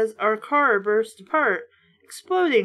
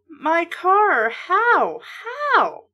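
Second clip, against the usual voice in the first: horrified cry; 7 semitones higher, much slower than usual. A narrator's reading. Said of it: "Dad choked out in a horrified whisper".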